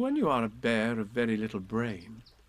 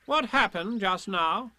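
slow, higher, self-important question; solemn; 8 semitones higher, 4 dB louder, slower than usual. A narrator's reading. Rabbit questions Tigger about the bouncing, solemnly. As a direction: question him slowly and importantly. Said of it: "he said solemnly".

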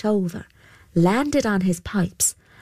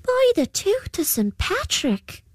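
much higher astonished exclaim; amazed boy; 13 semitones higher than usual. A narrator's reading. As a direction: gasp it out like a dazzled lad. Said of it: "he declared".

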